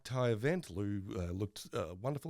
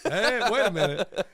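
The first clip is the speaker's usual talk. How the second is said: mock outrage; 8 semitones higher, 10 dB louder than usual. Continuing the outraged bit.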